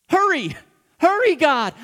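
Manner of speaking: loud, high urgent cry of hurry; panicked hurry. Retold or imitated. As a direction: push God to hurry, scared of getting caught. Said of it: "all I'm thinking about is".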